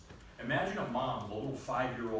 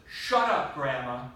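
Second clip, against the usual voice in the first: retold outburst; 9 dB louder, slower than usual. Boy to his arguing grandmother, a loud retold outburst.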